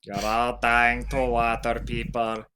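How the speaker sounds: low, grumbling cartoon character voice; Loogie Baloogie voice. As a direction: drop low and grumble like a gruff oddball. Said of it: "I'll do the Loogie Baloogie voice".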